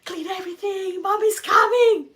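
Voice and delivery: very high, very loud frantic shriek; panicked shout. Retold or imitated. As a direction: scream it as the sister hears mom's car. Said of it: "completely freaking out".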